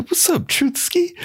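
high, slow laid-back greeting; smooth homie voice. Retold or imitated. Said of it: "He said".

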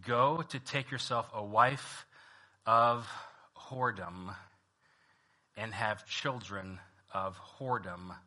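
soft, measured Bible reading; reading Hosea to the congregation; scripture reading. Acted. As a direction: read the text quietly and steadily. Reads Hosea chapter one aloud.

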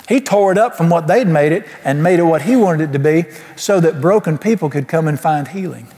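ordinary talk

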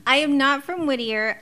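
ordinary talk, nothing marked

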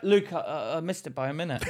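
low, very soft and hesitant; small-voiced pretend admission; sheepish mock confession. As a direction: confess it meekly, trailing down.

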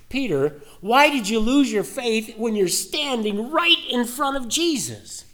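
Jesus questioning Peter. Acted. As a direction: lift into Jesus's voice, pleading why.

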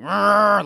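loud, drawn-out bull bellow; bull roar. Retold or imitated. Co-host imitating the screams heard from a metal bull, roaring. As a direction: roar like a bull, high and loud over the talk. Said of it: "like a bull sounds".

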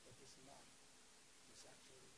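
very low, soft voice; subdued sceptical murmur; hushed doubt. Acted. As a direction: quietly insist on proof. Voices disciples' doubting answer.

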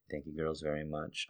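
whispered thanks. Real, not acted. Host thanking listeners, in a whisper. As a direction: whisper it as a quick aside.

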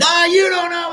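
high shouted jab; mock family argument. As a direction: shout the objection across the table. Family arguing at dinner.

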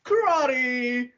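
high, drawn-out imitation battle cry; high-pitched parody of a karate fighter; mock karate cries. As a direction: stretch it out like a silly martial arts call. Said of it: "doing karate noises".

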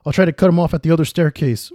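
high, loud urgent shouting; guard barking out a plan, high and loud; shouted orders. A narrator's reading. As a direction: shout orders to your partner in a chase. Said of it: "one of the guards shouted".

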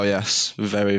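normal talking voice

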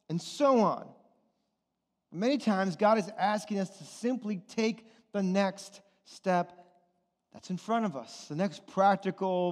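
usual speaking voice

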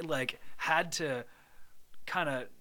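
ordinary delivery

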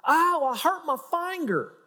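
put-on high, slow kid with a country accent; imitated child's drawn-out country twang; childhood country accent. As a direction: whine it out like a little country kid, accent thick. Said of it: "listen to our accents when we were kids".

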